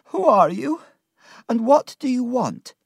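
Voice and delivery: high, loud, wary questioning; distraught woman. Acted. Martha questions the strangers after crying, high distraught voice.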